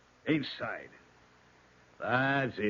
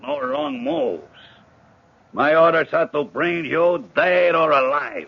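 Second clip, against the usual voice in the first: loud threat; 10 dB louder than usual. Acted. Hijacker warning the king he can be taken dead, loud. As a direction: lay down the threat hard and loud.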